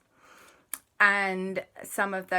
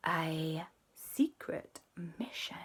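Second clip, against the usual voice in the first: slow secretive whisper; 7 dB quieter, slower than usual. Acted. JB telling the kids about a secret mission, whispered. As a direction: hush it like a secret.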